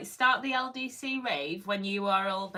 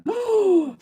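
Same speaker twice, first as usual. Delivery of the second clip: delighted gasp; 12 semitones higher, 7 dB louder than usual. Real, not acted. Reacts to the rollerblades idea.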